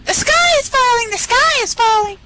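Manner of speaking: very high squawky hen; high-pitched chicken alarm; clucking chicken panic. Acted. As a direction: shriek it like a frantic chicken. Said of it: "clucked Chicken Licken".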